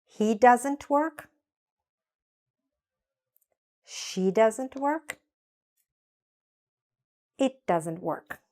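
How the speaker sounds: slow, clear drill lines with pauses; slow repeat-after-me drill. Shown as a demonstration. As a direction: model each line slowly for the class. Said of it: "Let's hear it and say it".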